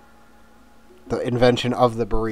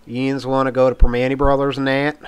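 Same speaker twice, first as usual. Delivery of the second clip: Pittsburgh Mon Valley accent, a bit louder; local Mon Valley accent; 2 semitones higher, 6 dB louder than usual. Shown as a demonstration. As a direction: put on the old Mon Valley accent from childhood. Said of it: "let's see if I can do it".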